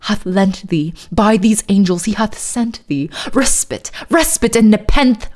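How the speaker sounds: poem recited in put-on British; hammed-up British poetry reading; fake British accent. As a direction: read dramatic verse in a mock British accent. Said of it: "middle of a fake British accent".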